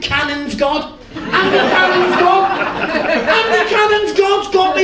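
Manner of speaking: high, loud, laughing cheer; a factory worker yelling his good news, laughing; ecstatic shouting. Retold or imitated. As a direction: bellow it like you can't stop telling everyone. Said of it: "He couldn't shut up".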